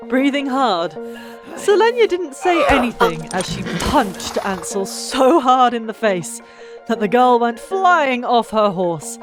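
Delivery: ordinary delivery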